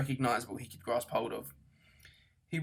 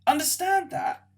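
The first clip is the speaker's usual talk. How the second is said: high, loud incredulous shout; shouted in outrage; 8 dB louder, slower than usual. A narrator's reading. Arthur to Ford about destroyed Earth, outraged shout. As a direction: yell it back at him in disbelief. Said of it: "shouted Arthur".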